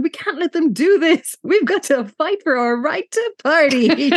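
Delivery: rally the party from a tabletop; rallying yell. Retold or imitated. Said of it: "starts yelling".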